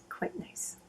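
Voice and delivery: tiny, very soft whisper; admiring whisper. Real, not acted. Vlogger admiring the swatches, whispered approval. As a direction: drop right down into a hushed whisper.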